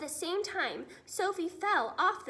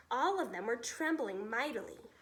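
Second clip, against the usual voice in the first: usual speaking voice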